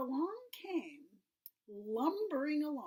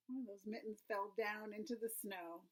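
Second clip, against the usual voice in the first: ordinary delivery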